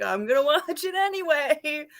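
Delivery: high, sing-out retort; playful defiance. Retold or imitated. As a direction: defy them like a cheeky kid. Acting out her childhood defiance.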